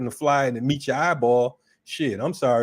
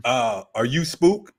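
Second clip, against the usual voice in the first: mock cop voice; slower than usual. Cop questions him at house.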